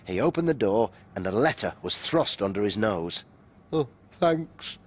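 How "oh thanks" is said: sleepy. A narrator's reading. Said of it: "he said sleepily".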